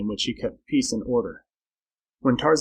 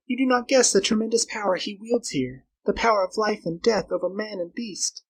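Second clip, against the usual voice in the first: high female voice, growing hushed; fearful Flora; 8 semitones higher than usual. Acted. Girl warning Carl of Tarzan's power, fearful. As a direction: warn him urgently, fading into dread.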